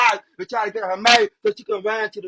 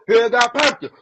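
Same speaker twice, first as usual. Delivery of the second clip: normal talking voice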